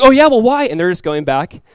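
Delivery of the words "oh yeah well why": high, loud mock challenge; obnoxious retort. Students arguing back at the atheist guest, obnoxious. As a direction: throw it back like an obnoxious classmate. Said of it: "they're kind of being obnoxious back".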